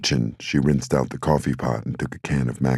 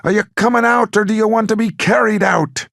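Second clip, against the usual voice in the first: playful gangster shout; 6 dB louder than usual. Acted. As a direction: bellow it like a movie gangster at a standoff. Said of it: "He positioned his hands ... and shouted".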